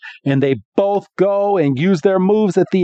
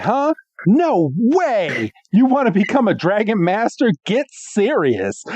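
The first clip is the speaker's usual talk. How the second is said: higher, scoffing voice that slows on the end; mocking disbelief; 6 semitones higher than usual. Georgia mocking Iris, a lightly voiced high scoff. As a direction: sneer at the girl's dream of becoming a dragon master. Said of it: "And she's like".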